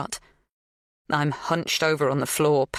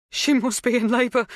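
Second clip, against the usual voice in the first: wobbling voice; 8 semitones higher, 3 dB louder than usual. A narrator's reading. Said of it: "her mouth wobbling around the words".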